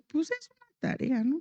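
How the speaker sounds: high questioning tone. Retold or imitated. Mother voicing herself to husband.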